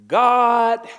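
God punched high and loud; stress on God. Shown as a demonstration. Preacher stressing God to the congregation. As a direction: hit the word God hard and high. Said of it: "I'm gonna put emphasis on two words".

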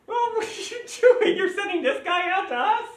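high sneering giant's taunt; mocking Goliath. Goliath taunting David with high mockery. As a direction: jeer at the little kid.

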